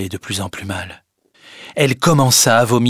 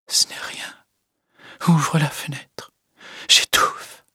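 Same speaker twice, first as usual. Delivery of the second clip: faint whisper; 7 semitones higher, slower than usual. Acted. Dying Emma asking Charles for air, whispered. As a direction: barely get the words out.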